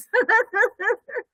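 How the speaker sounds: short high real laugh; a quick amused laugh. Real, not acted. Host laughs at own quiz.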